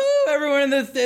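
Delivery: ordinary talk, nothing marked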